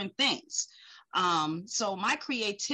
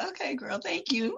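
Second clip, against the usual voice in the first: laughing; 6 semitones higher than usual. Retold or imitated. Retold reply while laughing.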